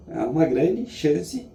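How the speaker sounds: ordinary talk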